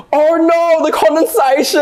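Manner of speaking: squeaky dramatic parody voice; high mock dramatic. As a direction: gasp it out like a melodramatic mermaid. Parody quote, high pitch.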